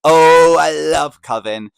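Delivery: loud, high, drawn-out gush; adoring, lingering exclamation; gushing delight. Real, not acted. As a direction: swoon over it, stretching the words out. Gushing over a favourite season.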